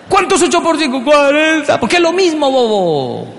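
furious adult demanding the answer; yelling drill. Retold or imitated. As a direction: shout the question at the kid.

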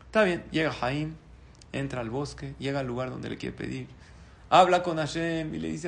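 normal talking voice

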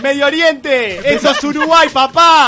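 loud, high celebratory yelling; triumphant taunting. Real, not acted. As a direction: roar out the victory, punching each phrase. Host gloats in victory.

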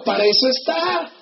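normal talking voice

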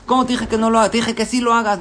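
high, loud outraged shout; furious retort. Acted. Boss yelling at the manager over the deal, furious. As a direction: blow up at him.

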